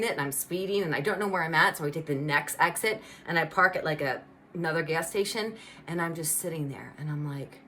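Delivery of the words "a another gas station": ordinary talk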